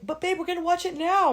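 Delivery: high mock-whiny pleading; high, wheedling put-on voice; pleading partner. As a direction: imitate a partner begging. Imitates partner pleading to watch.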